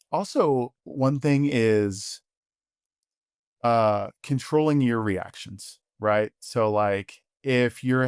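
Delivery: ordinary delivery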